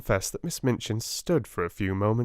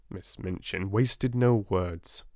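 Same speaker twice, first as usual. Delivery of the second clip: plain reading voice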